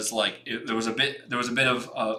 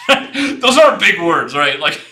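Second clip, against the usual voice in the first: laughing; 6 semitones higher, 8 dB louder, slower than usual. Real, not acted. Laughs reading wordy resume.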